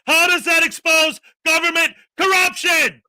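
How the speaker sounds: loud, incredulous demand; exasperated shout. Real, not acted. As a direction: shout the question in disbelief. Exasperated outburst at critic.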